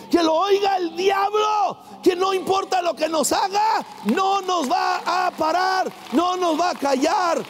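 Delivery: high, shouted, defiant proclamation; full-force preaching. Real, not acted. Preacher rallies congregation to keep worshipping, defiant shout. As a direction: hammer each phrase for heaven and demons to hear.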